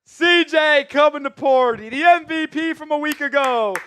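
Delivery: high, loud announcer-style hype; hype shout. Real, not acted. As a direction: belt it out like a ring announcer. Hype celebration of a player.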